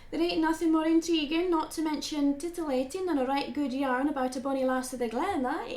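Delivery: put-on a light, teasing Scottish accent. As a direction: play the bonny Scottish lass with a light Scottish lilt. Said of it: "why do you think I put on this voice for you".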